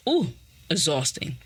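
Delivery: usual speaking voice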